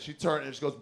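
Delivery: ordinary talk, nothing marked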